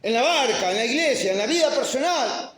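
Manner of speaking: high, loud preaching, each phrase pounded; pulpit preaching. Acted. Pastor stirring the congregation about life's storms, fervent preaching. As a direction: preach it like storms are hitting.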